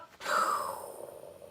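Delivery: long, breathy exhale; drawn-out airy sigh. Real, not acted. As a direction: blow out a slow sigh. Exhales after drawing a card.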